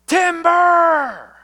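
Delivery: high, drawn-out lumberjack holler; full-voiced bellow of alarm, falling away; warning shout. Shown as a demonstration. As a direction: shout it long and loud, like a tree is falling. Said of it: "Why do people shout".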